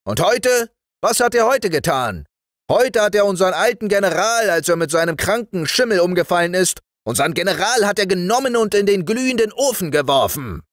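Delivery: high, loud, rapid accusation; indignant soldier figure. Acted. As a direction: rattle off the outrage at a high pitch. Speech of the toy army.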